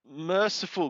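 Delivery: ordinary talk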